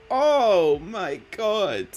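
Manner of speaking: loud, drawn-out delighted shout; real loud delighted gasp of words; excited exclamation. Real, not acted. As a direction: squeal it out slowly with delight. Sees a cute picture.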